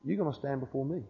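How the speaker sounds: very soft, gracious promise; hushed, tender retelling; loving, merciful. Retold or imitated. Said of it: "merciful, gracious that I sensed".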